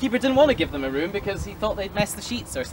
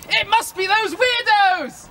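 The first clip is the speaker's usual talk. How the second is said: higher, slow, theatrical blame; mock accusing; 10 semitones higher, 4 dB louder, slower than usual. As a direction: point the finger dramatically. Innkeeper blaming sailors.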